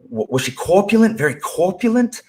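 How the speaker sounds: high loud goofy quote voice; high, loud, goofy impression of Lenny asking; Lenny quoted. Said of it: "Lenny is like".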